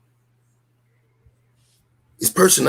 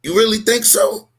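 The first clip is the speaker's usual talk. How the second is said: higher rhetorical challenge rising at the end; dramatic rhetorical question; 10 semitones higher, 3 dB louder than usual. Acted. Challenging his co-host with a rhetorical question, dramatic rising tone. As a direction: throw it back as a dramatic rhetorical dare. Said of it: "I'm being dramatic".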